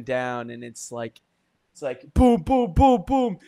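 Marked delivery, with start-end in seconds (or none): 2.1-3.4 rising, punchy vocal boom sound effects; mock action booms. Telling a friend about the raid, with punchy booms. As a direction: beat out the gunfire like a movie soundtrack. Acting out a kitchen shootout.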